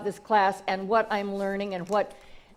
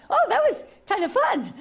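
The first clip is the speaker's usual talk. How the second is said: put-on delighted baby eagle; 12 semitones higher than usual. Baby eagle trying to fly.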